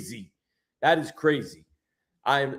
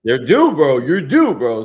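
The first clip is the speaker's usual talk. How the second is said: hyped up; 7 dB louder than usual. Real, not acted. Cheering a friend on.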